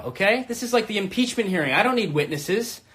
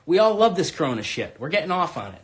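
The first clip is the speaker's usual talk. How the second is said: usual speaking voice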